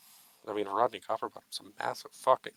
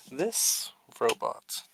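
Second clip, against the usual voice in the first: mock solemn tribute. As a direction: give a grand, mock-serious speech.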